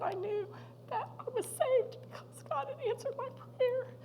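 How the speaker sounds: high voice, moved to tears; choked-up testimony. Retold or imitated. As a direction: quote it with a tearful catch. Retells father's moment of being saved.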